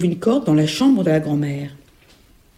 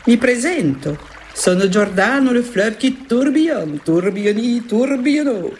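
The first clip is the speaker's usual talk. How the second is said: put-on swirling singsong Italian accent, higher; Italian-accented river; 5 semitones higher than usual. River Giordano greeting the little girl, Italian accent. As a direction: introduce yourself as a flamboyant Italian river.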